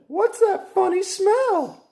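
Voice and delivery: put-on high, slow girl voice; high slow curious girl. A narrator's reading. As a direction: sniff suspiciously as a little girl. Frogalina voiced.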